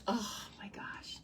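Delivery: breathless whispered gasp, unvoiced; soft slowed whisper of delight; hushed oh my gosh. Real, not acted. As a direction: whisper it as if a secret thrill. Excited whisper about new season.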